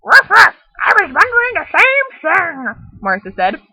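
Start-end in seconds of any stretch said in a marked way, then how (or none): 0.0-2.6 high, loud yappy puppy barking words; talking dog voice. Acted. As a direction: bark like an eager little dog. Dog Martha agrees.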